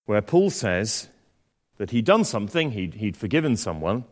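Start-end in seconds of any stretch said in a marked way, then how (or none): none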